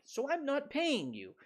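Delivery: a cocky debtor brushing them off; smug debtor. Retold or imitated. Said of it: "they can just say".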